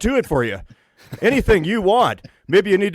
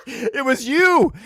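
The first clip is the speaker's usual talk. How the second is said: high, slow and laughing; 12 semitones higher, much slower than usual. Real, not acted. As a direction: laugh it out in surprise. Laughing at discovery.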